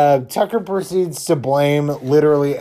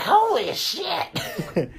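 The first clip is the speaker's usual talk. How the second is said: high, drawn-out, softer mock scream; imitated shock; 13 semitones higher, 7 dB quieter, much slower than usual. Dale's group seeing the nails, imitated shock. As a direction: stretch out a squeaky mock shriek. Acting shocked Dale and friends.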